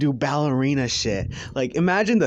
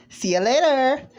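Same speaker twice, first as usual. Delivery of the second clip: high, loud singsong sign-off; cheeky farewell; 13 semitones higher, 5 dB louder than usual. Acted. Deku does the peace sign before leaving, playful high goodbye. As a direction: toss it out brightly, stretching the end.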